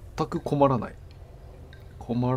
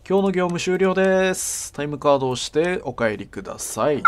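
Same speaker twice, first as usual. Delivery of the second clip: higher, louder mock staff announcement; workplace announcement; 5 semitones higher, 6 dB louder than usual. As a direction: announce closing time like a store PA. Mock end-of-shift announcement. (業務終了のアナウンス風)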